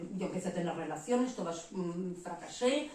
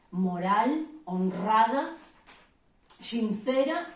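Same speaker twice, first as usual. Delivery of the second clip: emphatic, louder list; 3 semitones higher, 7 dB louder, much slower than usual. Real, not acted. Preacher telling her listeners what her daughters needed, louder. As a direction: build each word up louder.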